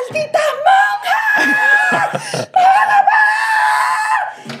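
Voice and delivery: hammed-up outraged father screaming through laughter; father bursting in on the nuns, yelling; furious dad yell. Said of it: "me encontró un cuarto encerrado y dijo".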